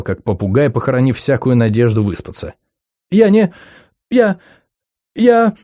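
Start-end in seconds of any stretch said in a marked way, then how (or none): 3.1-5.5 trembling stammer. Acted. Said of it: "сотрясалась девица".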